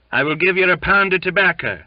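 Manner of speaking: high, loud, quick wheedling; conciliating whine. A narrator's reading. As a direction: whine a bribe to placate him. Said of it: "he whined in a conciliating voice".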